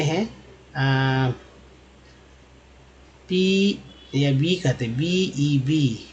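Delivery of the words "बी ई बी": "बी ई बी" spelled out slowly, letter by letter. Shown as a demonstration. Teacher spelling a word slowly for students. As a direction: drag out each letter of "बी ई बी". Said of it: "ये बी जो है".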